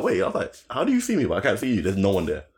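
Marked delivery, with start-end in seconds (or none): 0.7-1.2 surprised. Retold or imitated.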